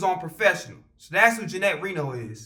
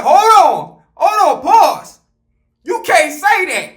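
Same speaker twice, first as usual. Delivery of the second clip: much louder and higher, slow, stop everything; loud objection; 9 semitones higher, 13 dB louder, much slower than usual. Retold or imitated. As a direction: shout him down to halt the insult. Bathroom confrontation, objecting loudly.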